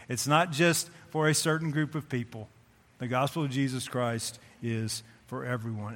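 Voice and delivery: usual speaking voice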